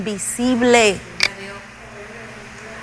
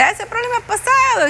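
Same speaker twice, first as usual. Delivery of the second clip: high, quick exclamation brushing it off; mock dismissal; 11 semitones higher, faster than usual. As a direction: wave away an old problem in a raised pitch. Acts out later dismissive reaction.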